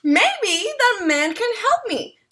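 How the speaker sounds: light up with hope; hopeful. Acted.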